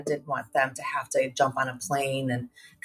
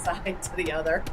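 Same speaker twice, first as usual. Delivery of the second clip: usual speaking voice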